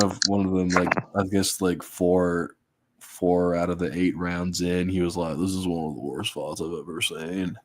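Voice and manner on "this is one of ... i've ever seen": friend's voice. Retold or imitated. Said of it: "he said it like that".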